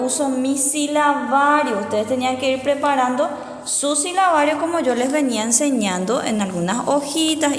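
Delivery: normal talking voice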